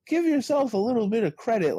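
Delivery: high, coaxing encouragement; coaxing a modest developer to take credit; encouraging. Retold or imitated. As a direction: lift it up high to cheer him on. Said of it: "I'm like".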